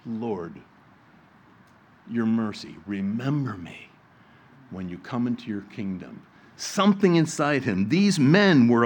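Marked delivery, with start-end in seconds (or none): 0.1-6.2 a dying thief's whispered-soft plea to his Lord; simple plea. Acted. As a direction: beg quietly for mercy. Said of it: "It was a simple plea".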